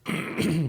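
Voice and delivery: quick throat clear. Real, not acted. Clears throat mid-sentence.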